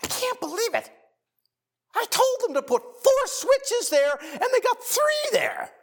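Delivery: high, loud, strained mock tirade; freaked-out rant. Preacher mimicking his brother's outburst about light switches, high strained rant. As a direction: imitate the brother losing it over switches. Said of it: "he just sort of freaked out".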